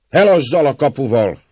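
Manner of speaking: loud, high shouted demand; shouting. A narrator's reading. Rough man stirring the crowd at the gate, shouting. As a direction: call it out to the whole mob. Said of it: "kiálta".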